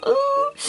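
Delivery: high, drawn-out playful "ouh"; mock pained ooh. Vlogger facing the thirties milestone, mock pained ooh. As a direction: wince aloud in mock pain. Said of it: "ça fait mal".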